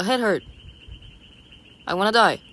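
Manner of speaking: usual speaking voice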